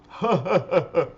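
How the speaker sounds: loud, high, pulsing put-on laugh; demonstrated joke laugh. Shown as a demonstration. Showing the host how a movie joke sounded, put-on laugh. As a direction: force out a run of high ha-ha bursts. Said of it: "Something like that, but in Australian".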